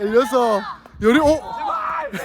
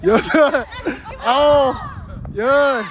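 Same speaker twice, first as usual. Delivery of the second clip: ordinary talk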